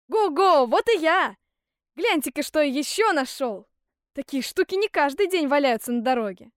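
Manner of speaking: loud, high triumphant holler; exuberant calling out. A narrator's reading. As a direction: hail them loudly, showing off. Hans hails his brothers again. (громкий ликующий оклик)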